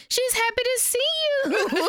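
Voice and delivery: very high, sugary voice; fake sweet translation. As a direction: chirp it up high to cover the truth. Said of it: "Bae talking about".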